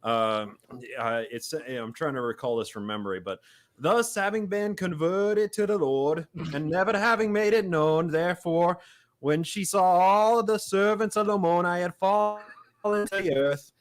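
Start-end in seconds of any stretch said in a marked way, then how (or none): 3.8-13.7 higher, louder Appalachian reading, slow; hammed-up Appalachian scripture; mock Appalachian scripture reading; Appalachian accent. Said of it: "I can try and mimic it".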